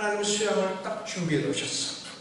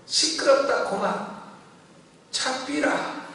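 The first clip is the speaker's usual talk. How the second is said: higher-pitched Gyeongsang rebuke; Gyeongsang dialect; 6 semitones higher, slower than usual. Retold or imitated. Woman rebukes Satan, imitated in Gyeongsang dialect. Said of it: "경상도 말로".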